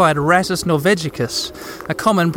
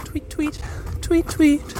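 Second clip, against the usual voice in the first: high chirpy tweet tweet; cartoon bird voice; 8 semitones higher, 5 dB quieter, slower than usual. Imitating bird while following.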